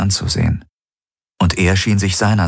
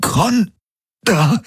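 gasped out; gasping. Acted. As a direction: breathe it out in shock. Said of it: "keuchte Garlin".

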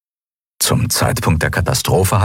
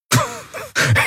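croaking laugh; 5 semitones higher than usual. A narrator's reading. Hurt prisoner producing a laugh at the commodore, croaking. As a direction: force out a weak, rasping laugh. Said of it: "ein krächzendes, leises Geräusch".

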